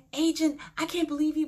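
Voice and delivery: high-pitched pretend indignation at the agent; mock outburst. Imagined scene before the conference room, high mock-outraged outburst. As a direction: act out the scene you could have made. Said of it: "maybe I could have showed my butt".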